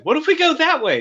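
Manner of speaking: put-on high, pleading little kid voice; a small kid begging dad; child voice. Kid asking dad again.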